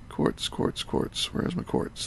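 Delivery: whispered search for quartz; whispering. Real, not acted. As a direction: whisper it to yourself. Searching inventory, muttering to self.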